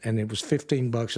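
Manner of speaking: ordinary talk, nothing marked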